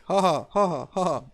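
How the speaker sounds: loud, spoken "ha ha" taunt; mock gloating laugh. Norsemen laughing in your face, mock gloating laugh. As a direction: imitate Norsemen jeering with a forced laugh. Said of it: "Norsemen are just laughing in your face".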